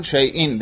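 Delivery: شیئن pronounced "shay-in", falling; kasra ending. Shown as a demonstration. Teacher showing hamza takes every case, "-in" ending. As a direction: close the list with شیئن ending "-in". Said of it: "حمزہ کے اوپر تینوں اعراب".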